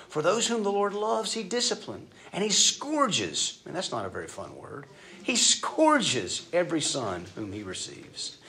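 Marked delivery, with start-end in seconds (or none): 0.1-3.6 higher, slow stress on scourges; preacher stressing the hard word; emphatic reading. Shown as a demonstration. 5.2-8.4 slow, weighty repeat; preacher repeating the verse for weight; slow repeated emphasis. Shown as a demonstration. Repeating the phrase slowly.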